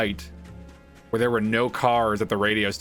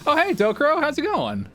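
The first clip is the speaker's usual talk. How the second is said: excited greeting. Real, not acted. Greeting chatter in stream.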